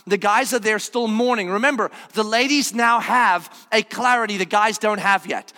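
ordinary talk, nothing marked

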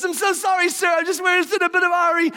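high, rushed apology; hurried Mary. Acted. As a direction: gush it while hurrying away.

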